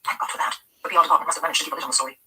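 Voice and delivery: plain reading voice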